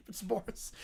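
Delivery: ordinary delivery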